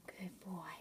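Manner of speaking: soft whispered praise. Real, not acted. Mother whispering praise to the cat. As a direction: breathe the praise without voice.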